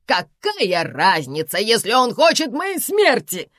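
loud, slow exclamation, climbing high; exclaiming. A narrator's reading. Karina accuses husband of wanting her death, exclaiming. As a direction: cry out in dramatic exasperation. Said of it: "воскликнула Карина".

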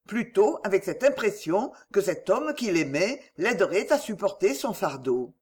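ordinary delivery, nothing marked

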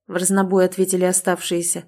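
ordinary delivery

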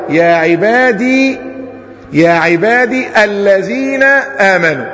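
slow "عبادي" with a long final ee, kept apart from "الذين"; original full form. Shown as a demonstration. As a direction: draw out the ya of "عبادي" before "الذين". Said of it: "لكن هو أصلها إيه؟". (نطق بطيء بإثبات الياء)

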